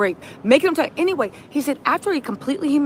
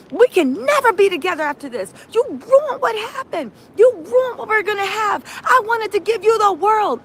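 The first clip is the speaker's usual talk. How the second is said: high-pitched, wounded mock tirade; retold berating; 8 semitones higher than usual. Host voices him ending the relationship, high berating lament. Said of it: "He literally started berating her".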